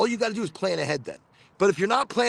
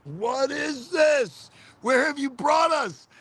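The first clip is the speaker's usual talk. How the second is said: high, slow, startled question rising to a shout; high startled cry of confused children; bewildered kids; 9 semitones higher, slower than usual. Retold or imitated. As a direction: act out a baffled kid dragged along. Kids confused in red light district.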